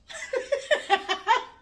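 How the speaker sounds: high cackling laugh. Real, not acted. Amused at her own joke to viewers, high laugh. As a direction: burst out in a high, loud cackle.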